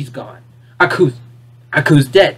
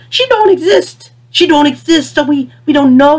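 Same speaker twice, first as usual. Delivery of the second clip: much higher, pitch jumps on "She don't exist"; exasperated outburst; 8 semitones higher than usual. Real, not acted. Arguing with Jack's happy ending, exasperated and high. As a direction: blurt it out in disbelief. Outraged point about Ashi.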